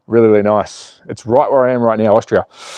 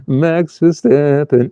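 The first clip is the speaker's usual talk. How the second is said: announcer voice; much slower than usual. Pretend. Announcing driver name.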